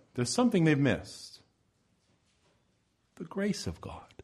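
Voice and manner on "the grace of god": hushed whisper, breathy reveal. Acted. Preacher reveals the missing answer in a whisper. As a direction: let it drop to a reverent whisper.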